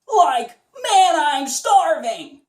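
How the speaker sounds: high cartoon drawl. Shown as a demonstration. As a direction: top of the range, held. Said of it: "see how high your voice can go".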